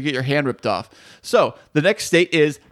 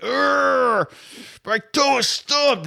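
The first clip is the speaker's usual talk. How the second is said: mock pain; 8 semitones higher, much slower than usual. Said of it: "and you're like".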